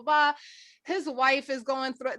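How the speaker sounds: normal talking voice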